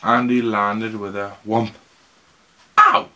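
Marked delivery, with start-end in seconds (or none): 2.8-3.1 high, loud startled ouch; pained yelp. Acted. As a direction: yelp as if hit. Character bashed on the bottom.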